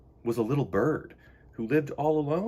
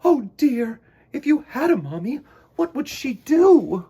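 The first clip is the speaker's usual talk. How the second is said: high, loud, tender mama bear; sighing bear; 11 semitones higher, 9 dB louder than usual. Acted. Missus Bear sighing over Choco, higher and louder. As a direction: sigh with kind concern. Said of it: "she sighed".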